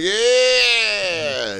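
long, high whooping yeah; hammed-up drunken cheer, soaring then falling; drawn-out cheer. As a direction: stretch it out like a party cheer. Acting out a revved-up drinker's return.

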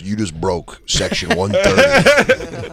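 put-on slow, gruff old New York union guy; old New Yorker. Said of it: "some old New Yorker comes up and he goes".

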